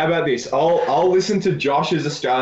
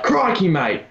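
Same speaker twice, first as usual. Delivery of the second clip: kid's high, mocking Aussie imitation; mock Australian accent; 6 semitones higher than usual. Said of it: "this one kid would look at me and just be like".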